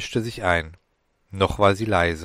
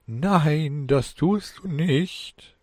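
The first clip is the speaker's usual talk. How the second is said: high, slow, forced soothing voice; a voice in his head trying hard to sound gentle; attempted gentleness; 6 semitones higher, much slower than usual. Demon soothing Alan with a forced, slow, gentle tone. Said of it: "versucht sanftmütigen Tonfall".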